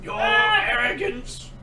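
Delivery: slow, high sinister sneer; Emperor voice. Acted. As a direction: hiss it like the Emperor. Star Wars Emperor impression.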